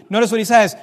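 ordinary delivery

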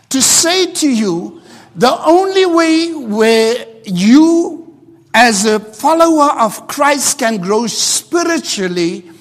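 ordinary talk

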